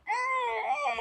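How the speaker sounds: sudden high yelp of pain; retold startled cry. Wife crying out to husband from a leg cramp, high yelp. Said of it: "At the top of her lungs".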